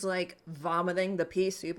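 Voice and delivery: usual speaking voice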